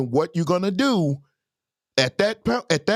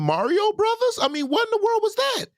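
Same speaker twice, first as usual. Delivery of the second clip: mock disbelief; 14 semitones higher than usual. Real, not acted. Mocking the PlayStation.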